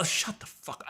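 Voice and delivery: under the breath; whispered put-down of the commentator; muttered aside. Real, not acted. Podcaster whispering at the useless commentator.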